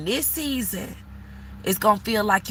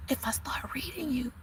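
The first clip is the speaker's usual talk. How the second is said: whisper; 3 semitones higher, 13 dB quieter than usual. Acted. Tarot reader hushing her warning to the viewer, whispered. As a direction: drop to a hushed whisper as if holding back. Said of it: "I gotta say it like that".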